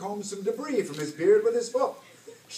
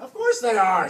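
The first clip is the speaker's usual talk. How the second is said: loud, raised-pitch captain's retort; exasperated retort; 6 semitones higher, 9 dB louder than usual. A narrator's reading. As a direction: shout down the underling's doubt. Captain snaps at mate's doubt.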